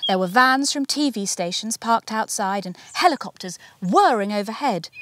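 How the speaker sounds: ordinary delivery, nothing marked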